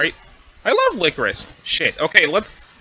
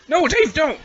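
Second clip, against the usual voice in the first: urgent cry; 10 semitones higher than usual. Acted. Pleading to stop Dave.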